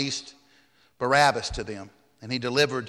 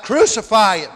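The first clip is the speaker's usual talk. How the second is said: higher, louder mob cry; crowd crying out; 7 semitones higher, 6 dB louder than usual. A narrator's reading. Crowd answers Pilate about Jesus, crying out. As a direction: call it out like a demanding crowd. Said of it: "the crowd cries out".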